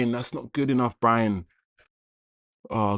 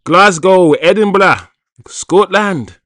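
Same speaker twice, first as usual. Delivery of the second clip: loud, high mock Scottish accent; rubbish Scottish accent; 6 semitones higher, 9 dB louder, slower than usual. Host guessing a viewer's Scottish hometown, rubbish Scottish accent. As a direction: call out the places in bad Scottish. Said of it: "That's a rubbish Scottish accent".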